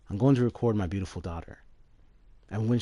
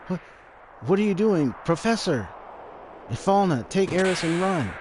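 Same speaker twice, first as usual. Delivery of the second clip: much higher, louder urgent cry; alarmed shout; 10 semitones higher, 5 dB louder than usual. Acted. Gast crying to Ifalna to flee, loud and high. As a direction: yell a warning to get away.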